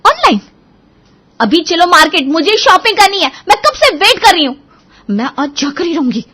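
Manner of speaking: high, loud insistent wife; indignant demands. Acted. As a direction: insist on getting your way. Wife demands outing in quarrel.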